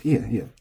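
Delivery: ie ie clipped short; quick double ie; shortened casual ie ie. Shown as a demonstration. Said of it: "Often very shortened".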